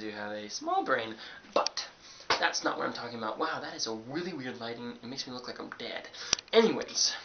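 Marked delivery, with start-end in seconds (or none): none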